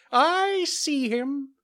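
very high, slow bird voice; falcon voice. Acted. Falcon replies in story.

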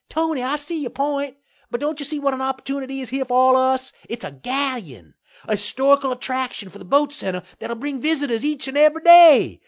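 very high, quick character voice; eager placating mayor. A narrator's reading. Mayor selling the attraction to the developer, eager placating. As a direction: pitch the idea fast and high, ending loud.

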